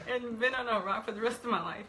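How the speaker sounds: talking through real, self-mocking laughter; nervous laughing. Real, not acted. Guest admitting they would have fled the show, laughing. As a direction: laugh your way through the confession. Laughing at own discomfort on air.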